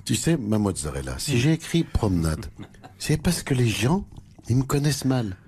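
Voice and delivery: low-pitched, halting caricature of a former president; Nicolas Sarkozy. Acted. Husband explains his art book to his wife, low caricatured politician voice. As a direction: speak low, in self-justifying bursts. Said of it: "l'ancien président Nicolas Sarkozy".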